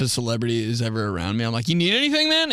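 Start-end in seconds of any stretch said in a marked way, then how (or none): 1.7-2.5 higher-pitched, over-polite offer; eager politeness. Acted. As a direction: offer help a little too eagerly. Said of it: "I'm just real polite and shit".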